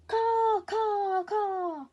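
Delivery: very high, very loud caws fading a bit; loudest caws. Acted. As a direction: caw as loud as you can. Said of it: "louder than ever".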